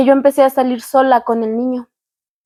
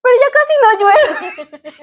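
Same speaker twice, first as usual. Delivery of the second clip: mock whiny stalling; 9 semitones higher, slower than usual. Daughter stalling with mom.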